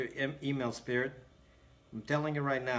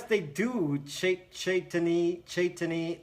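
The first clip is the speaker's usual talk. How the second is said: normal talking voice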